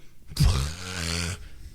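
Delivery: low, blown-out raspberry-like sound; drawn-out mimicked exhaled noise; imitated cartwheel noise. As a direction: blow a long low noise, mimicking him. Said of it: "he's doing cartwheels and going".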